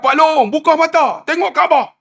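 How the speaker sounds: high-pitched, insistent urging; imitated urgent plea, pitched high. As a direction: urge a frightened man on. Urging man with eyes shut.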